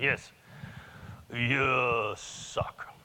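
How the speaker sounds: lightly voiced Japanese accent, drawn-out blunt "you suck"; a hint of Japanese-accented English, dry and blunt; Yasu's Japanese accent. Friend bluntly telling the speaker he sucks, light Japanese accent. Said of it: "So I'm gonna do Yasu's voice".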